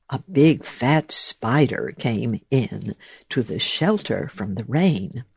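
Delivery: usual speaking voice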